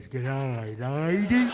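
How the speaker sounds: low, soft, cheeky mock-tough g'day; boy turning the tables on the lady; gruff little boy reply. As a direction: play the boy answering back, deep and dry.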